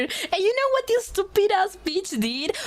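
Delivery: high, indignant rant; exasperated outburst. Real, not acted. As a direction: vent at the cousin's stupidity. Exasperated rant about cousin.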